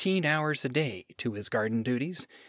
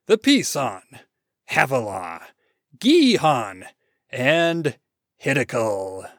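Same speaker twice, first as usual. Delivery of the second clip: booming roll-call of names, each set apart; grand announcement; 6 dB louder than usual. Acted. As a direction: proclaim each name like a marvel. God grandly announces river names.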